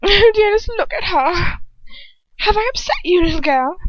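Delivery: high, loud sneering taunt; mocking villainess. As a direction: purr down at the little girl in mock pity. Voices Bellatrix mocking.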